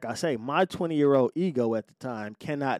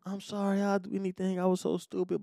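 mock begging ex; 5 semitones higher, 4 dB quieter than usual. Ex begging to return.

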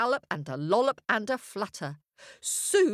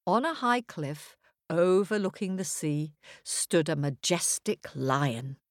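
ordinary delivery

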